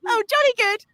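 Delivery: silly upper-class British; mock posh British. Castmate joins the joke in a posh British accent. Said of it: "You sound like Pip".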